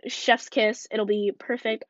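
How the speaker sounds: normal talking voice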